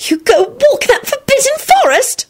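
very high, aghast squeal; horrified squeal. A narrator's reading. Elizabeth shocked at Lupin's forest walks, high squeal. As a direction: squeal it in disbelief, right up high. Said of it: "she squealed".

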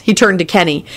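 plain reading voice

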